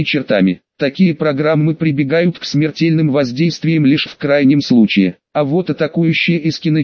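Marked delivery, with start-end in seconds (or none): none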